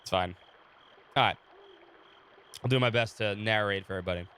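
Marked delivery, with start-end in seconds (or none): none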